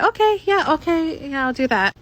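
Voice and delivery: high-pitched, overly agreeable okay; imitating awkward, eager compliance; awkward agreement. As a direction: go high and accommodating, easing down. Awkwardly agreeing to pay for herself.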